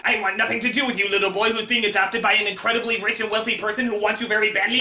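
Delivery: low, fast growl of a grumpy loner; deep and quick, brushing the kid off; gruff Wolverine voice. Acted. Wolverine brushing off the kid, low and fast.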